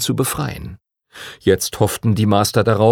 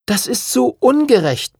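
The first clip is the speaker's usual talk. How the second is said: put-on much higher girlish protest; complaining. Lucy protests to the teacher, high and aggrieved. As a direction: whine that it isn't fair. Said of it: "beschwerte sich Lucy".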